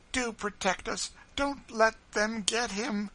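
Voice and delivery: high, desperate plea; wild pleading. A narrator's reading. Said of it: "she said wildly to Missus Bird".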